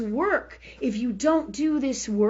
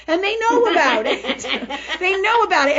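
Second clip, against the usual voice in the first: high, loud words through laughter; laughing; 5 semitones higher, 5 dB louder than usual. Real, not acted. Telling listeners her family does it too, laughing. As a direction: let the laugh spill over the words. Amused at family's shared habits.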